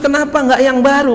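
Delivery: high loud cry of regret; anguished cry. Acted. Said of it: "kata dia". (teriakan menyesal yang keras)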